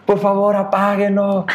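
high, whiny mock sobbing; imitated crying plea. Crying son begging family to switch it off, mock wailing. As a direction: beg in a drawn-out tearful wail. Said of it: "lloraba y lloraba y decía".

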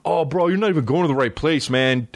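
high, mocking bro voice; know-it-all commenter. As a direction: parody a pushy local telling him off. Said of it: "somebody will write me".

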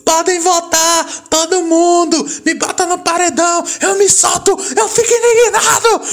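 mock furious Gil. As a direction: wail it like a contestant losing his temper. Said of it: "o Gil fica puto e fala".

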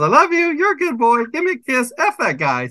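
very high squawky parrot imitation, dropping on the last line. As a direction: mimic the parrot's mixed-up phrases. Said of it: "And now my bird goes".

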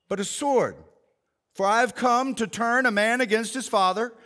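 measured, solemn Bible reading; scripture reading. Acted. Pastor reading Jesus's words to the congregation, solemn. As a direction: read the verses with weight.

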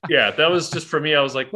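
ordinary talk, nothing marked

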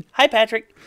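high, chirpy cartoon greeting; SpongeBob impression. Fan quoting SpongeBob greeting his friend, high cartoon voice. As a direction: pitch it way up like a cartoon.

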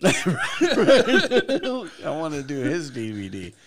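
slow, laughing through words; can barely talk for laughing. Real, not acted. Laughing at comedian bit.

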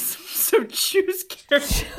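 laughing, high and loud. Real, not acted. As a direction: laugh through the warning. Playful warning.

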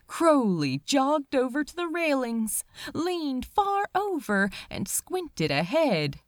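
ordinary delivery

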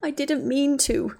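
crying confession; sobbed. Acted. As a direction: sob it out. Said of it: "Ginny sobbed".

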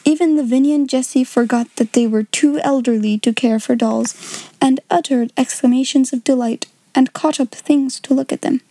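usual speaking voice

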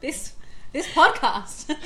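slow, loud laughing words. Real, not acted. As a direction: laugh the answer out slowly. Laughing joke answer.

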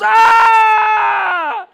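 loud high sustained scream; scream of pain. Acted. Stage Jesus hit with the wrong sword, screaming. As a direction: scream as if stabbed for real. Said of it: "this Jesus goes".

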